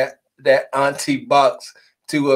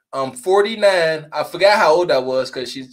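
ordinary delivery

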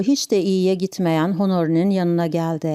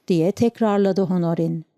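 ordinary delivery, nothing marked

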